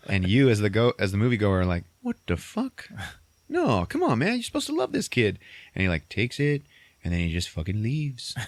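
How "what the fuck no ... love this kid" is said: high-pitched mock dismay; indignant protest. Moviegoer protesting the theft from the kid, dismayed. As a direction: plead in disbelief, voice way up.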